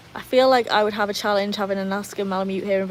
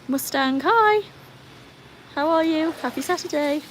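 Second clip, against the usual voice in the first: cheery greeting; 7 semitones higher than usual. Real, not acted. Greets an arriving viewer.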